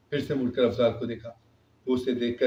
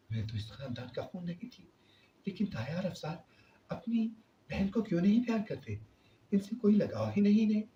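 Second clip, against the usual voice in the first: inner thoughts; 7 semitones higher, 6 dB quieter than usual. A narrator's reading. Rabia's inner wondering voice.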